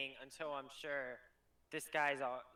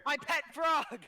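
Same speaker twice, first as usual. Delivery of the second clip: high, loud cry; sudden loud outburst, pitched way up; excited shout; 9 semitones higher, 6 dB louder, slower than usual. Acted. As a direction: shout it out over the table. Player calls out during game.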